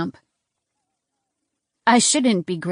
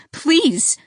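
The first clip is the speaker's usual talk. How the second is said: high pleading cry; desperate plea; 8 semitones higher, 4 dB louder than usual. A narrator's reading. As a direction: beg with everything. Begs to reach sister.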